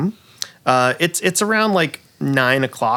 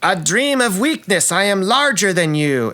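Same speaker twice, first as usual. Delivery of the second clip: high, theatrical villain taunt; gloating snake villainess; 7 semitones higher than usual. Acted. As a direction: boast like a hammy stage villain. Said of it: "multi-headed snake actress".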